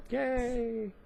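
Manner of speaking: high, happy little cheer. Real, not acted. Vlogger cheering at hitting thirty minutes, excited. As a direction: celebrate finishing right on time.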